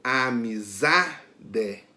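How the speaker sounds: amizade slowly, leaning on the syllable za; very slow amizade with za stressed. Shown as a demonstration. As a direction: hit the za in amizade, stretching the word out. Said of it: "que é pronunciada com mais força é za".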